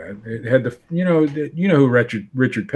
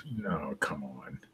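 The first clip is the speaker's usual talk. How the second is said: whispering; 7 semitones lower, 18 dB quieter, slower than usual. Real, not acted. Whispered aside.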